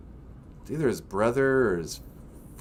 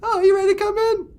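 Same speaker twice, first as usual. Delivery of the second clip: squeaky, fast puppet voice; high cartoon character asking; 7 dB louder, faster than usual. Puppet voice asks.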